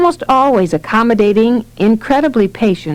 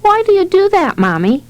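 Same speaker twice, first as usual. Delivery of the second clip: put-on higher-pitched child's question; fascinated little boy; 10 semitones higher than usual. A narrator's reading. Johnny asks his mother about cold cream, high childlike curiosity. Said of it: "Phlegmatic Johnny watched, fascinated".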